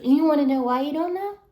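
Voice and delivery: 1 indignant. Real, not acted. Angry retort to the lady.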